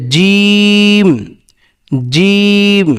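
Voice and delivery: long, level জিম with a firmly pressed j; pressed Arabic jim. Shown as a demonstration. Teacher repeats জিম for learners, pressed and prolonged. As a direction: hold জিম out, mid-tongue tight against the palate. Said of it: "একটু চেপে ধরে বলতে হবে".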